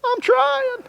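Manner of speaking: drawn-out, very high whine; whiny voice. Shown as a demonstration. Preacher leading the congregation in a whiny chant. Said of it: "say it with a whiny voice".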